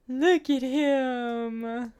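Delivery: slow, high, gushing admiration; gushing awe. Real, not acted. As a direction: stretch it out, starstruck. Admiring the character sprite.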